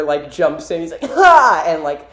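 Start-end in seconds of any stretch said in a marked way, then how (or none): 1.0-1.6 high, loud "ha"; mock battle yell; attack cry. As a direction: imitate a cartoon fighter's lunge. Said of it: "And then Silver".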